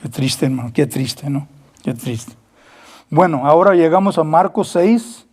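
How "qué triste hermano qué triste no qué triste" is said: low whispered lament, barely voiced; sad whisper. Real, not acted. Preacher to congregation, mourning the lost, whispered. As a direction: breathe it out as a grieving hush. Sad aside after the quote.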